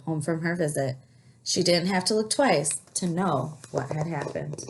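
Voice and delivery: plain reading voice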